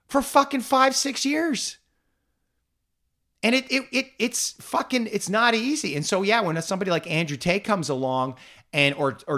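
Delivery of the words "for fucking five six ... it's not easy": impassioned venting. Real, not acted. Venting years of hurt.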